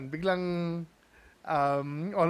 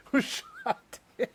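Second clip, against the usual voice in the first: laughing; 9 semitones higher than usual. Real, not acted. Laughing at the irony.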